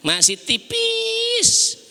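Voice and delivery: high, very slow, stretched tease; mocking stretch of the words; teasing drawl. Real, not acted. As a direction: draw it out to gently tease. Preacher teasing small faith.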